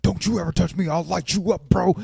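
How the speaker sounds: mock tough-guy threat; mock angry freak-out. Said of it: "Warren freaks out".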